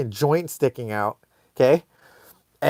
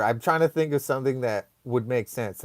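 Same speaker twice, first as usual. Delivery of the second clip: usual speaking voice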